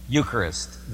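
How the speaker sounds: usual speaking voice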